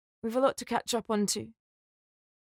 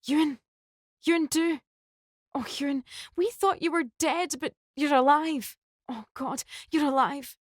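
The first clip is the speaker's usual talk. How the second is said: high, upset pleading through tears; tearful shock; 7 semitones higher than usual. Acted. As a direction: cry out his name in disbelief. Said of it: "with tears streaming down her face".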